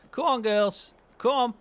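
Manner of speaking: calling the cows to follow. Real, not acted. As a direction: coax the cows along with a call.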